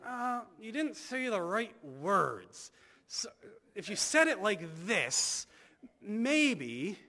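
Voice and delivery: slow, high, nitpicking mock voice; mock fussy Jesus. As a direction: ham up a picky gatekeeper. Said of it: "you never have Jesus saying".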